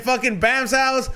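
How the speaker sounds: ordinary delivery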